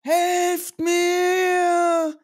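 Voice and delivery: high, loud calling out through the storm; a captive woman crying out for rescue; desperate cry for help. Acted. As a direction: shout to be found, voice high and strained.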